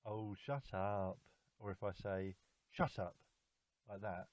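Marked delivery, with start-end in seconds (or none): 0.1-1.2 drawn-out, playful "shut up"; joking around. Shown as a demonstration. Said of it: "The first one is like I'm joking around". 2.7-3.2 short, sudden angry "shut up". Shown as a demonstration. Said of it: "the second one is I'm angry".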